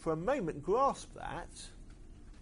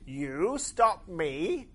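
loud, slow, mocking machine voice; scoffing tank; 4 semitones higher, 5 dB louder, much slower than usual. Tank mocking Marvin for trying to stop it, slow loud scoff. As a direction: drag it out in disbelief, sneering at the tiny robot.

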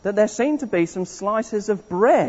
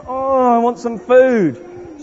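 slow, moaning whine for food; hungry whine; 4 semitones higher, much slower than usual. Shown as a demonstration. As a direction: moan as a hungry Israelite. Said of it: "we started going".

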